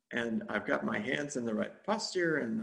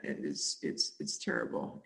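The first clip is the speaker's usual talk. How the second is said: normal talking voice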